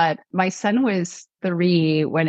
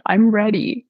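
through tears; 2 semitones higher, 3 dB louder than usual. Retold or imitated. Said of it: "through snotty tears".

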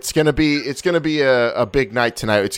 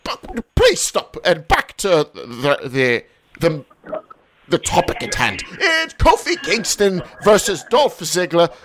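put-on British correspondent, higher, with a laugh; hammed-up posh Brit, a bit higher; mock British accent; 7 semitones higher than usual. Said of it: "your Australian accent".

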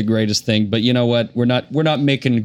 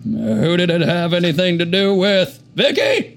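Bill Clinton; 6 semitones higher than usual. Acted. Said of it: "play this role as Bill Clinton".

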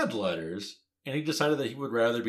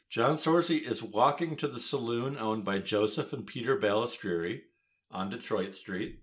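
ordinary talk

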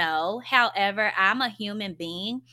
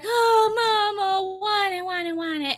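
exaggerated whiny child, sliding gradually down; mock toddler whine; 10 semitones higher than usual. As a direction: imitate a whiny little kid, pitched way up. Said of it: "he's whining and he's like".

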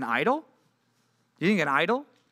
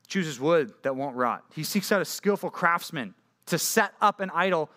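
usual speaking voice

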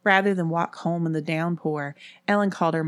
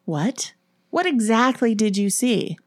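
raised-pitch startled questioning; disbelieving; 6 semitones higher, 4 dB louder than usual. A narrator's reading. Erin questioning the frightened girls, disbelieving. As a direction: press for answers in disbelief. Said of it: "Erin's eyes widened in disbelief".

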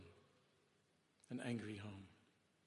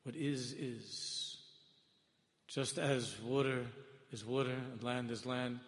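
usual speaking voice

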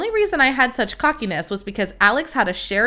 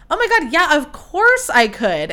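higher-pitched, gushing retold yes; eager acceptance; 6 semitones higher, 3 dB louder than usual. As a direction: agree instantly, thrilled to be asked. Retelling her eager acceptance.

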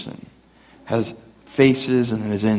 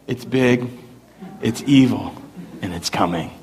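mock spooky voice; 3 semitones lower than usual. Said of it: "I'd go".

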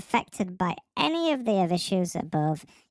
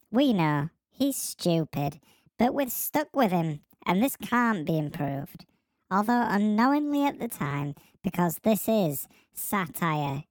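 ordinary talk

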